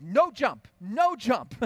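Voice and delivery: put-on high, loud stubborn baby protest; a frustrated toddler saying no; toddler refusing. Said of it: "she's like".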